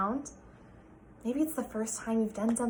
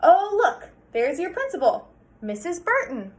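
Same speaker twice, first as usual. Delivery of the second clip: cheery dad; 6 semitones higher, 7 dB louder than usual. Acted.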